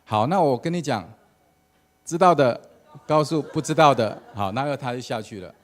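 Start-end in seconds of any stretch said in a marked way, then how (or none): 0.0-4.2 much louder, higher punchline; triumphant pastor. Retold or imitated. The pastor tells the congregation the punchline, loud and high. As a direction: deliver the clever answer with a big lift. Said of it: "然后他就说".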